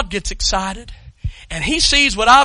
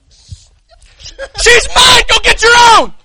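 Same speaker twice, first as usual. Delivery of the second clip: playful shout; 13 dB louder, much slower than usual. Acted. Acted grab for a convert.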